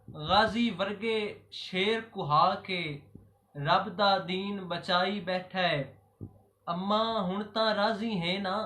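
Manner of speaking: rhythmic Punjabi elegy in short, paused phrases; poem recitation. Acted. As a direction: recite devotional verse line by line, letting each ending fall. (ਠਹਿਰ-ਠਹਿਰ ਕੇ ਸ਼ਾਇਰਾਨਾ ਅੰਦਾਜ਼ ਵਿੱਚ ਕਲਾਮ ਪੜ੍ਹਨਾ)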